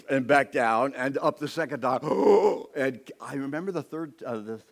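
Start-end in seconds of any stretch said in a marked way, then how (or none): 2.0-2.7 sharp high gasp for air; imitated gasp. Preacher reenacting his own gasp while nearly drowning. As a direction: gasp as you surface.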